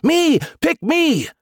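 very high, drawn-out begging; squeaky, desperate eagerness; eager pleading. A narrator's reading. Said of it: "the missiles all pleaded".

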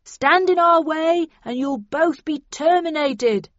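high, slow shouted ultimatum; loud, measured threat from outside; bullhorn yell. A narrator's reading. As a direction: yell demands as through a bullhorn. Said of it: "yelled the bullhorn again".

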